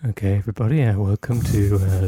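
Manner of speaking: lightly creepy host voice; a hint of a creepy storyteller. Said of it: "Doesn't have to be with a creepy voice".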